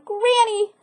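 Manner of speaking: put-on hungry boy calling out; pleading cry. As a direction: beg Granny for food, hungry and eager. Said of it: "He ran into his house crying".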